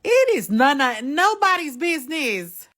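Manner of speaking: high, loud, slow indignation; indignant outburst. Real, not acted. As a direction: make them back off, loud and high. Outburst about meddling.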